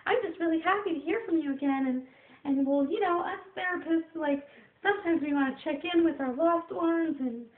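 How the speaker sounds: very high, loud put-on voice; impersonated therapist. Therapist checking in with a loved one, high-pitched impersonation. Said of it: "I like impersonating people".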